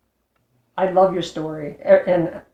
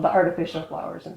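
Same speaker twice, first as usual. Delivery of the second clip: usual speaking voice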